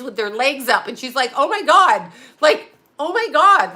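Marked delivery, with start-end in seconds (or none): 1.3-3.8 shocked gasp. Retold or imitated. Jenny shocked at waxing room.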